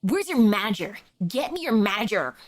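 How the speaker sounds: low, demanding customer voice; entitled customer. Acted. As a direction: bark for the manager, low.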